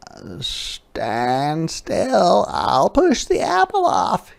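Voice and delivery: high, eager squirrel chatter. A narrator's reading. Squirrel offers to help Hedgehog, high and eager. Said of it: "he said".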